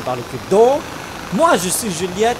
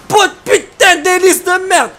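furious shout; 12 semitones higher, 6 dB louder than usual. Real, not acted. Attacked by enemy, swearing.

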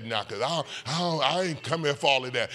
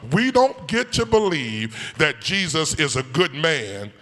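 ordinary talk, nothing marked

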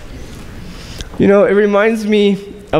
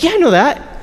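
confident reply; 8 semitones higher than usual. Retold or imitated. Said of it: "I was like".

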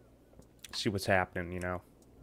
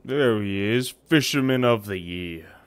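loud slow announcing; 9 dB louder, slower than usual. Acted. Hammed greeting to game character.

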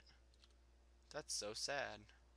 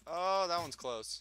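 startled, louder and higher; surprised; 6 semitones higher, 8 dB louder than usual. Real, not acted. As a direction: react to a near miss. Cannon shot lands near.

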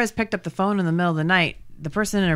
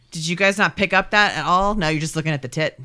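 ordinary talk

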